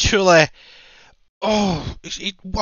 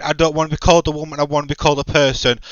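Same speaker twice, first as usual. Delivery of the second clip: fast, lower mocking voice; mocking quote; 7 semitones lower, 5 dB quieter, much faster than usual. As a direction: rattle it off in a sneering mock tone. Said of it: "half the women saying".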